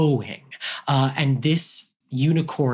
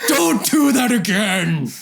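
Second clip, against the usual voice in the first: ogre voice; 8 semitones higher, 4 dB louder than usual. Acted. Ogre warns the thief.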